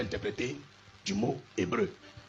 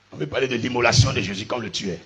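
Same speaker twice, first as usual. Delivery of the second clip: usual speaking voice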